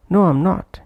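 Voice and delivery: "I'm" reduced between a high "No" and "not"; weak-form "am". Shown as a demonstration. Teacher demonstrating the weak form in an answer, "I'm" not prominent. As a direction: keep the "am" in "I'm" short and unstressed. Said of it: "that's why not pronounced prominently".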